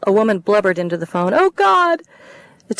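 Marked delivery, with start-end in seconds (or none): 1.3-2.0 high, loud, panicked sobbing cries; hysterical sobbing. Acted. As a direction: break down in hysterics on the phone. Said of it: "Sobbing, hysterical".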